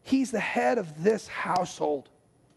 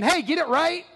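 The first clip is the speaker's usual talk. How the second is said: mock stern God; 5 semitones higher than usual. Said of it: "I'm coming down and saying".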